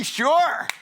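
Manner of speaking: high, drawn-out "sure"; eager recruit. Acted. Acting an eager recruit's answer.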